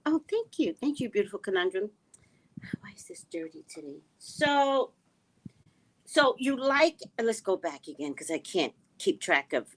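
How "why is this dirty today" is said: whispered aside. Real, not acted.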